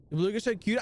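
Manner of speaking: ordinary delivery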